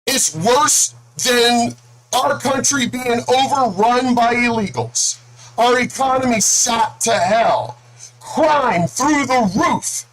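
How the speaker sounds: high, outraged questions, slowed on key words; angry disbelief. Real, not acted. Vlogger venting at Biden voters, angry and incredulous. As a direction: hammer each outrage in disbelief. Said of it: "I'm actually angry".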